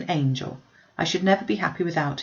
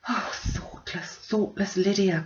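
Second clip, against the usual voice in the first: slow, soft, breathy lament; Oh whispered out in dismay, then softly; anguished cry; 3 semitones higher, 4 dB quieter, slower than usual. A narrator's reading. Elizabeth reading the letter, softly anguished. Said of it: "cried Elizabeth".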